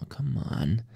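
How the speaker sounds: ordinary talk, nothing marked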